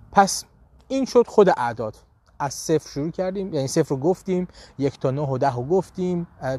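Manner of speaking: usual speaking voice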